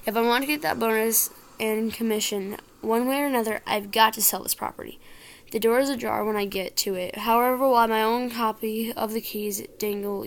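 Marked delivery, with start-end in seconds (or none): none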